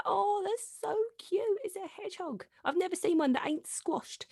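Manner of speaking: high, soft cooing; cooing at hedgehog. Retold or imitated. Guest recalls first seeing the garden hedgehog, cooing high and soft. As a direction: coo over it like a baby animal. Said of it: "at first I thought".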